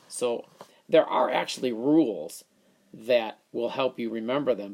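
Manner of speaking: ordinary talk, nothing marked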